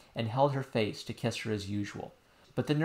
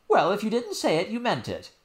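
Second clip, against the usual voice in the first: hurt nurse; 9 semitones higher, 4 dB louder than usual. Acted. Nurse voiced.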